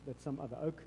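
ordinary talk

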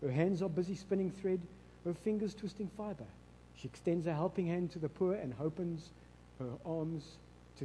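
soft, measured Bible reading; scripture reading. Acted. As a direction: read the verses gently to her. Reads Proverbs 31 aloud to wife.

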